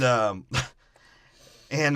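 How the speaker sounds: ordinary delivery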